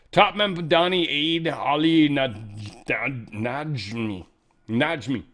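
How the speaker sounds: Najmi sounded out slowly, syllable by syllable; stumbling over name. Real, not acted. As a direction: struggle through Nad- Najmi. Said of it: "this rolls off the tongue".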